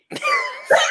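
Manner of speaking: squealing burst of laughter; high-pitched laugh. Real, not acted. Laughing at the scene.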